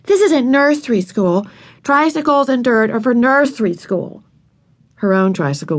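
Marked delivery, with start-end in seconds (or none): 0.0-4.2 higher, disdainful put-down; a big girl looking down on nursery school; scornful. A narrator's reading. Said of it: "Ramona was scornful".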